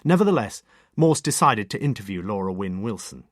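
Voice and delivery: ordinary delivery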